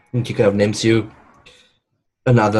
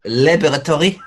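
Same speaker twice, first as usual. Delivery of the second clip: put-on Laboratory with a French accent; 7 semitones higher, 3 dB louder than usual. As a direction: say Laboratory the French way, raised pitch. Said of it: "There we go".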